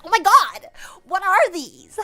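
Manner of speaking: loud, high shocked cry; shocked shout. Acted. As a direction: yell in horror at the mirror. Shocked at the mirror.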